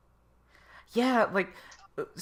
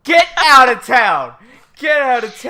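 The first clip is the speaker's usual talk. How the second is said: loud disbelief; 6 semitones higher, 11 dB louder than usual. Real, not acted. Fan reacting to a voice credit, loud high disbelief. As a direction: cry out in amazed refusal to believe it. Shocked by actor's credit list.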